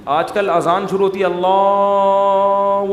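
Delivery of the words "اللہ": اللہ stretched very long and slow, mocking a muezzin; overlong drawn Allah. Mocking a muezzin who stretches the azan, drawn-out اللہ. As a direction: drag out اللہ far too long. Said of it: "وہ اتنا کھینچتا ہے اذان کو".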